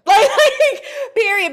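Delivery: high, loud laugh into the words. Real, not acted. Declaring she has no ties to him, through loud laughter.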